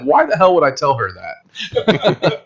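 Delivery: slow, laughing disbelief at the claim; half-laughing, slowed-down incredulous question. Retold or imitated. Sean, laughing at the actress's excuse, incredulous. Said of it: "And Sean's like".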